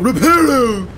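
high, loud, silly wizard cry; mock someone grandly waving a wand; mocking spell-caster. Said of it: "You're just waving your wand going".

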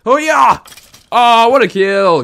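loud, high victory whoop; triumphant cheer. Real, not acted. Gamer celebrating a creeper kill, loud cheer.

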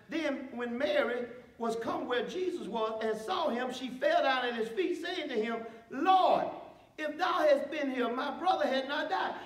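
measured, slowed Bible reading; scripture reading. Acted. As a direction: read the passage with weight. Reads scripture aloud.

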